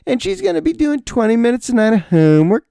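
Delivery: high-pitched incredulous report; mock dismay. Dad relaying first-grade homework load, mock dismayed. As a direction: pitch it way up in disbelief. Mocking school's homework announcement.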